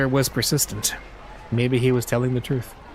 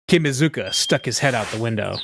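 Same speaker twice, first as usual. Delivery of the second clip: usual speaking voice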